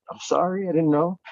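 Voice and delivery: playing innocent about the big win; mock innocent apology. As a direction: act out a bashful sorry to a grumbling friend. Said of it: "I'm like".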